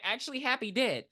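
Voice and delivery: ordinary talk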